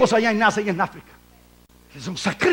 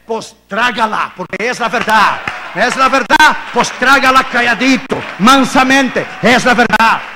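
louder, hammering the truth home; forceful rebuke; 3 semitones higher, 8 dB louder than usual. Real, not acted. Preacher scolding his listeners about the truth, forceful and loud. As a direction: make them swallow it.

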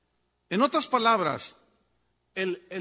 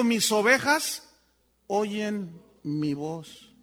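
slow solemn quote; 4 dB louder, much slower than usual. Acted. Quotes Jesus slowly.

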